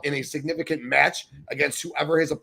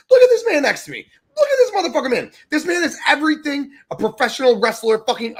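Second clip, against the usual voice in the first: much higher, louder hype yell; excited shout; 11 semitones higher, 7 dB louder than usual. Real, not acted. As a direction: yell it like a fan. Hyping the cohost beside him.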